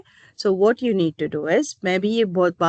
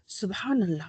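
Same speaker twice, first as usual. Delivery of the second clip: hushed, shocked exclamation; softly stunned; shocked reaction; 8 dB quieter than usual. Retold or imitated. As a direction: drop your voice, taken aback by what you heard. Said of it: "میں تو سن کر حیران رہ گئی".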